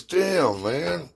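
a hint of a disappointed big guy; imitating Brian.

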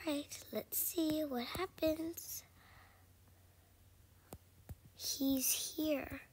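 slow tense whisper. Real, not acted. Monster returns.

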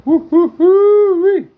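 high, loud, slow whooping; excited whoop. Real, not acted. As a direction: whoop with delight. Thrilled by the strike.

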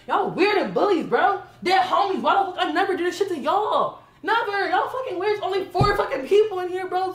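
furious, loud rant with bitter laughs; angry yelling. Real, not acted. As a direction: yell it out, fed up. Yelling at roommates in argument.

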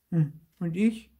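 high, wondering puppet voice; talking to himself. A narrator's reading. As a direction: ask yourself in a high little voice. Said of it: "sprach Zäpfelkern zu sich selber". (hoch, zu sich selbst)

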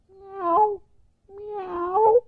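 high mewing kitten cry; kitty meow. Acted. A tired kitty meowing at the roadside. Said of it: "it was going".

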